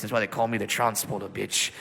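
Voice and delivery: put-on low British accent tag line; South London British accent. British soldier boasting in a low British accent. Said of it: "Jason Statham type British accent".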